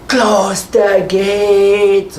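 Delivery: high, very slow drawn-out shout; running shout at the guard; Tick shouting. Acted. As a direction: yell it as he runs past. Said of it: "and he's like".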